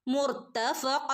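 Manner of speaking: slow chanted മുർത്തഫഖ; recitation. Shown as a demonstration. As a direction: end മുർത്തഫഖ with a two-beat stretch. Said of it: "രണ്ടനക്കം നീട്ടി നിർത്തണം". (നീട്ടി നിർത്തുക)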